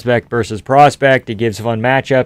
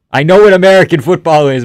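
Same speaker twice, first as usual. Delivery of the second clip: high, loud stress on know; correcting a misread line, emphatic; emphatic correction; 6 semitones higher, 8 dB louder than usual. Real, not acted.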